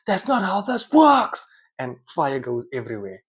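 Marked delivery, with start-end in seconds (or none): 0.1-1.5 high, loud, slow acted outburst; explosive mock-furious shout; exploding temper. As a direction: lose your temper and yell it, drawn out. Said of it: "she loses her temper and she explodes".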